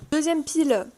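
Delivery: ordinary delivery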